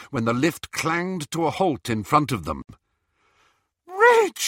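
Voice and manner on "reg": very high, loud scream; high-pitched cry of a wife to her husband. Acted. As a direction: scream the name out. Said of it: "screamed Missus Cattermole".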